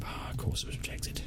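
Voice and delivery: whispered aside, grumbling to himself. Real, not acted. Manager grumbling that his transfer bid was rejected, whispering. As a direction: breathe it out in a whisper, resigned.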